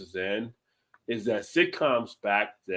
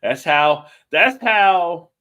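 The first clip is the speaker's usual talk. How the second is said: excited; 7 semitones higher, 7 dB louder, much slower than usual. Real, not acted. Host repeating the catchphrase, laughing.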